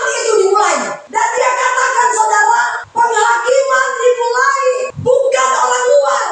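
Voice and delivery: high, ringing sung preaching; preaching at full force. Acted. Pastor warns his church, singing out in full preaching cry.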